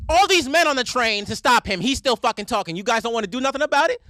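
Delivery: loud, high indignant outburst; imitated indignant yelling. Woman berating the men on the train, imitated yelling. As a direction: shout it at the whole carriage, acting her out.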